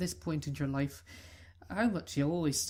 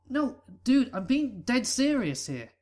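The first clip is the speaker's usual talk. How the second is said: louder, higher earnest protest; insistent protest; 6 semitones higher, 6 dB louder than usual. Acted. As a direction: push back hard, dead serious. Friend insists it's no joke.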